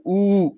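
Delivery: ऊ held long; long vowel. Shown as a demonstration. Teacher demonstrating big ऊ, long vowel. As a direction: draw out a single ऊ. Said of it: "long vowels की बात हो रही है".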